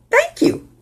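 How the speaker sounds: high-pitched, sing-song thank you; very high, polite brush-off; pert polite reply. Shown as a demonstration. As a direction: say it high as a neat dodge. Models a dodging polite reply.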